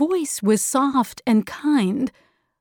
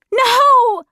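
put-on loud, high-pitched girl's scream; 13 semitones higher, 6 dB louder than usual. A narrator's reading. Meg trying to stop Charles Wallace, high loud scream. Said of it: "screamed Meg".

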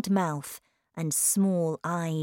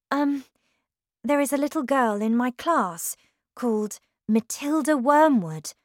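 lightly voiced nervous teacher, higher; a hint of a hesitant, polite young woman; timid young teacher; 7 semitones higher, 4 dB louder than usual. A narrator's reading. Miss Honey voiced in story.

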